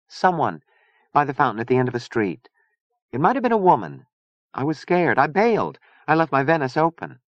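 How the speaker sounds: high, light character voice; young Chia, uneasy. A narrator's reading. Chia admitting she was scared and bailed, uneasy.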